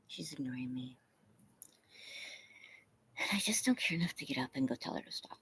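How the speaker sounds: fast whispered confession; whispered aside. Real, not acted. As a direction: whisper it like a secret to the chat. Aside while the dog ignores her.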